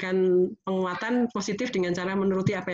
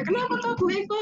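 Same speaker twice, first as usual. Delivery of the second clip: nosy neighbor; 14 semitones higher than usual. Retold or imitated. Neighbor calling out.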